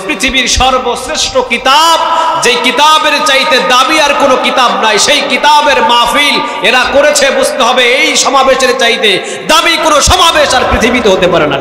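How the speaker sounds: impassioned Bengali waz shout; full-force preaching. Acted. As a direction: thunder it out to the crowd.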